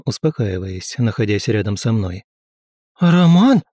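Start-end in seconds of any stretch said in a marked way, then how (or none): 3.0-3.6 higher, louder cry of the name; startled exclamation. A narrator's reading. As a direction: exclaim it as a startled young woman. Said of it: "Огнева воскликнула". (испуганный возглас)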